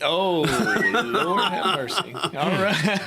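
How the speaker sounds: slow, laughing through the words; laughing exclamation. Real, not acted. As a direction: chuckle it out in mock exasperation. Cohost reacting to Lawrence's story.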